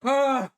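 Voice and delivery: loud, high "ah" of pain; pained cry. Real, not acted. Cry of pain while eating.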